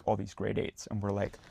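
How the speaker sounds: ordinary talk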